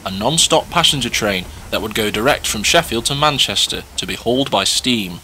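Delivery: ordinary delivery, nothing marked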